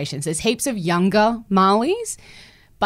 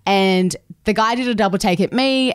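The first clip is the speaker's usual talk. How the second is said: normal talking voice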